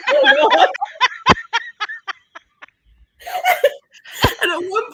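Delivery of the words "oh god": high, loud retold groan of horror; loud horrified reaction, retold; shocked outcry. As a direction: cry out in mock horror. Players react to wing being hacked.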